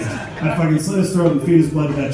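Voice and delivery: loud, furious rant; comic rant. Acted. Stand-up comic ranting at the crowd in fake fury. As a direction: tear into them.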